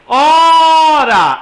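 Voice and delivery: "Ora" with a long, high first O; sílaba tônica marcada. Shown as a demonstration. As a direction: hold and lift the opening O of "Ora". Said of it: "a sílaba tônica é o O". ("Ora" com o O tônico bem alongado)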